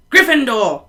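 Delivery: loud, high house call; Hat shouting. A narrator's reading. Sorting Hat announces the house, loud. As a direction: bellow it to the hall. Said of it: "shouted the Hat".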